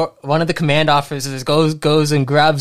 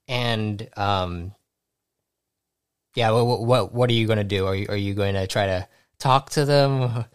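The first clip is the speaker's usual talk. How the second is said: ordinary talk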